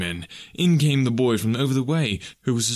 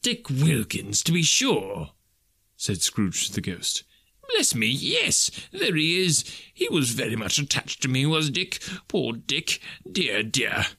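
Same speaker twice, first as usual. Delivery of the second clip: Scrooge voice; slower than usual. Acted. Scrooge reminiscing fondly.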